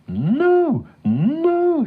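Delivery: very high, drawn-out cow cry; desperate protest. A narrator's reading. Cow protesting to the farmer, desperate. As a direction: wail each NO like a mooing cow.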